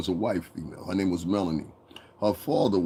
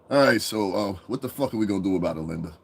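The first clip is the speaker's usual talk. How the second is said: plain-spoken boss; 2 semitones lower than usual. Acted. The boss asking Linda what to do, plain and offhand. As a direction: toss it out like a boss, no articulating. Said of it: "That's how the boss talks".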